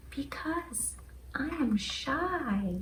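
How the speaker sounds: shy, slow, breathless whisper; trembling whisper. Acted. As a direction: whisper it like a timid creature. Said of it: "a trembling voice says".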